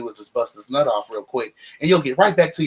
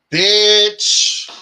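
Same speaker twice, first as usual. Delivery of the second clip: a high, loud, drawn-out "bitch" for the punchline; long scandalized cry; shocked exclamation; 7 semitones higher, 7 dB louder than usual. Retold or imitated. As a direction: hit it like a stunned gasp of disbelief. Dramatic punchline after confession.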